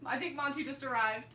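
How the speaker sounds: ordinary talk, nothing marked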